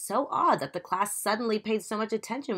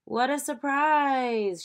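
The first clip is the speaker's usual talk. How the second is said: slow, higher, badly faked surprise; fake surprise; 8 semitones higher, 4 dB louder, much slower than usual. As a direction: drag it out like you already knew. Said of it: "she was no good at pretending".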